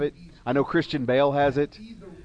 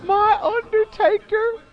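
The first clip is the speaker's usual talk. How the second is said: very high, slow, eerie manager wail; slow, eerie falsetto cackle of a spooky manager; Paul Bearer; 4 dB louder, much slower than usual. Retold or imitated. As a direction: moan it out like a ghoulish wrestling manager. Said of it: "throw out a little Paul Bearer for everybody".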